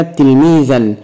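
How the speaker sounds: تلميذا as tilmiidhan; fatha tanween. Shown as a demonstration. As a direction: end تلميذا in "an". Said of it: "بالفتح مثل كلمة".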